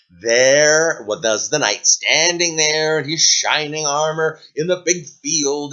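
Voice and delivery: ordinary delivery, nothing marked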